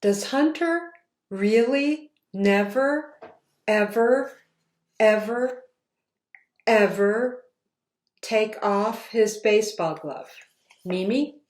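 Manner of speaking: plain reading voice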